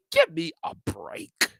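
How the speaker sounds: high, slow, scoffing give me a break; mock exasperation. Vlogger mocking the list author's excuse, high scoffing. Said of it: "I'm like".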